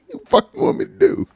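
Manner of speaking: high squeaky laughing what do you want me to do; laughing protest. Real, not acted.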